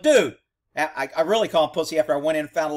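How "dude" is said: higher, louder "dude"; incredulous retold exclamation. Cop confronting surrendered suspect, incredulous. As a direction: call him out in disbelief.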